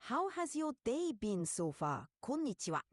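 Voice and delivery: stronger Japanese accent. Shown as a demonstration. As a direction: lean into the Japanese accent, with a crisp high "Konnichiwa". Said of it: "now sound a bit more Japanese".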